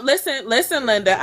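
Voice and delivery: usual speaking voice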